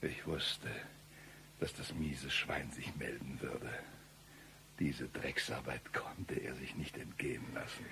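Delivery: low, hushed, venomous whisper; menacing whisper. Acted. Avenger whispers about the rat who will come. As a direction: breathe the grudge out quietly.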